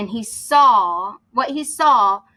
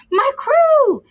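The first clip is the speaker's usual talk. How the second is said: very high, loud delighted cry; joyful surprise; 7 dB louder than usual. Acted. Captain spotting his lost crew, overjoyed cry. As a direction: burst out in glad surprise.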